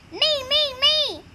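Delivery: loud, high "me, me, me"; excited, acted child's plea; eager volunteering. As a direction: clamour to be picked. Children clamour to be judge.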